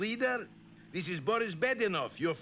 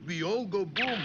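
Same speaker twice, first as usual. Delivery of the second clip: ordinary talk, nothing marked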